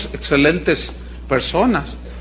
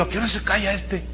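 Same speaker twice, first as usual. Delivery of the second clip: impatient mutter; 10 dB quieter than usual. Retold or imitated. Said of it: "el predicador hable y hable".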